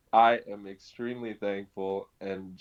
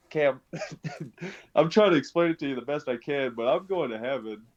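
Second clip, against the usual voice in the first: laughing while explaining; 6 semitones higher than usual. Retold or imitated. Laughing, excitedly explaining faith.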